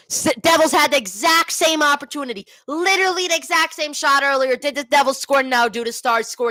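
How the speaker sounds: high-pitched, incredulous complaint; worked-up fan griping over a goal; exasperated rant. Real, not acted. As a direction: vent in disbelief at the team's luck. Venting after the opponent scores.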